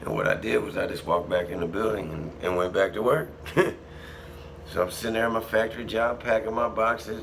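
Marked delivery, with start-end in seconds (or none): 3.4-4.7 short breathy laugh under the breath; quiet laugh. Real, not acted. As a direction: chuckle at the memory of the prank. Laughing at his own prank.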